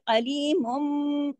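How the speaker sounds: अलीमुम slow, final nun sounding as m; Quranic recitation. Shown as a demonstration. Teacher models iqlab in अलीमुम. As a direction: draw out अलीमुम, end on a nasal m. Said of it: "मुन की आवाज ... change हो गई है".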